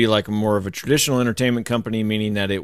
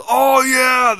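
much higher, louder shouted excitement; excited voice; 6 dB louder than usual. Retold or imitated. Showing the excited voice he used for Katie, high and loud. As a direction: burst out like thrilled fan. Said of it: "I used my excited voice".

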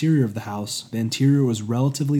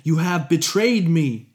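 louder, slow bitter exclamation; resentful cry; 4 semitones higher, 6 dB louder, slower than usual. Acted. Hephaestus accusing departing apprentice, resentful cry. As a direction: hurl the accusation after him. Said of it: "Hephaestus' resentful exclamation".

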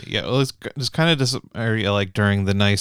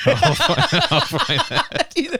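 high laughing squeak; laughing falsetto; much slower than usual. Retold or imitated. Voicing himself finding bike.

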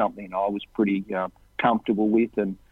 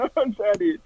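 high-pitched imitated near-tears whine; mock crying; 10 semitones higher than usual. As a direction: play a dieter close to tears, voice pushed way up. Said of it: "almost started crying".

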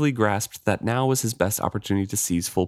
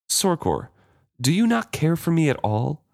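disarming directness; 6 semitones higher than usual. Acted. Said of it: "he asked with disarming directness".

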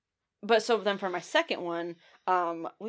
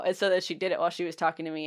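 ordinary talk

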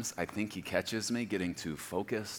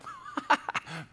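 airy chuckle; 8 semitones higher than usual. Retold or imitated. God laughing at Preston.

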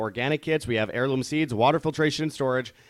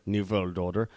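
put-on German accent, W turned to V in "world"; mock German villain accent on "new world order"; fake German accent; 3 semitones lower than usual. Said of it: "very, very fake German accents".

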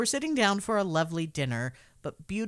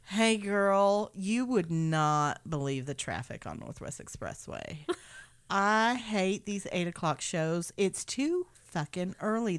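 sassy, weary drag queen, higher at the end; a tired queen venting before the show; 4 semitones higher, 3 dB louder than usual. Retold or imitated. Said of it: "He sounds like a drag queen".

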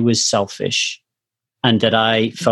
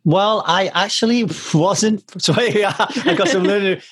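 laughing; 8 semitones higher than usual. Real, not acted.

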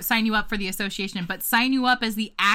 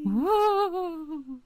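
high, drawn-out spooky wail; playful ghost "woo"; 7 semitones higher than usual. Pretend. Co-host teasing about scary stories, mock ghost wail.

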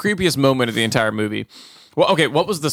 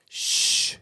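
soft unvoiced shush; 7 dB quieter than usual. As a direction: hush him quietly. Son hushes father.

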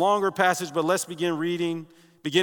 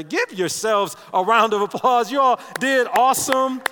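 excited praise; 6 semitones higher, 6 dB louder than usual. Real, not acted. Praising congregation after reading.

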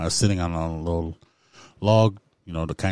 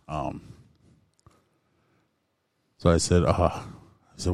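normal talking voice

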